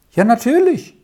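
put-on a boy happily confirming; cheerful. A narrator's reading. Lauri to Amai about the man, cheerfully confirming. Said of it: "bestätigte Lauri fröhlich".

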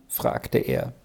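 ordinary delivery

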